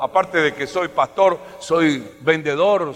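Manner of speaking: usual speaking voice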